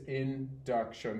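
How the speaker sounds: "induction" spoken slowly and carefully; slow and clear. Shown as a demonstration. Teacher summing up the word before a repeat, slow. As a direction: lay out "induction" slowly for the student. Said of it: "Say it one more time".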